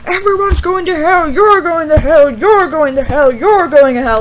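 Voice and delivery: loud fire-and-brimstone pastor impression; mock yelling preacher. Famous campus pastor condemning everyone, mock yelling. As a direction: hammer out each condemnation loudly, like a hellfire preacher. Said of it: "he sits there and he yells".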